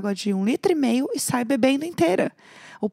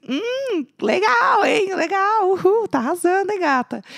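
higher, mock sarcastic cashier; cashier teasing; 7 semitones higher, 3 dB louder, slower than usual. Cashier teasing the loud singer at the till, higher mock sarcasm. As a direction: tease the singing customer. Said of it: "a moça do caixa assim".

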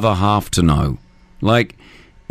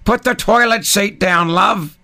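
high-pitched mocking nag from another era; mock an old-fashioned nagging partner; put-on higher voice, mimicking a tired cliché; nineteen-seventies cliché nag; 8 semitones higher than usual. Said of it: "What do I get, nineteen seventy-one?".

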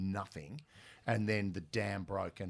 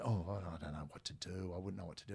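mock timid; 3 semitones lower, 9 dB quieter than usual. Retelling his own nervous reply.

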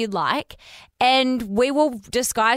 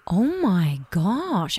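shocked gasp; much slower than usual. Real, not acted. Shocked by caller's confession.

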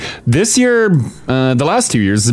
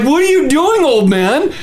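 high, raised disbelieving call; baffled exclamation; 12 semitones higher than usual. Retold or imitated. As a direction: react like he's lost his mind. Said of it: "And I'm like".

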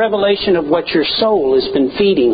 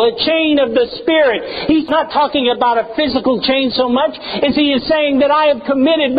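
high, ringing pulpit voice voicing Paul; full-force preaching; 7 semitones higher than usual. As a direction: preach it out as Paul, lifted and fervent.

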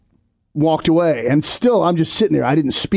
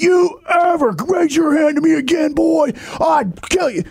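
high yelled furious father voice; angry father yelling at his son; dad's threat; 11 semitones higher than usual. Retold or imitated. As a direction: shout the threat like an enraged dad, slowing at the end.

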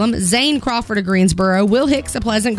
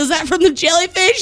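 tearful, higher question; crying; 7 semitones higher than usual. Retold or imitated. Mom asks her son about the googly eye, tearful. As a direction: ask it through mock sobs. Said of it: "I said".

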